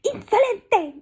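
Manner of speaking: very high, loud, slow outraged shout; drawn-out loud indignant cry; angry shout. Acted. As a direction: hurl the insult at him. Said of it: "gritó la lagartija".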